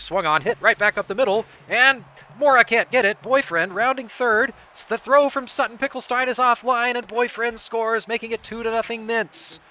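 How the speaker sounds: loud, high run-scoring call; excited play call. Real, not acted. Commentator calling the go-ahead run, excited. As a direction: yell it home as the runner scores.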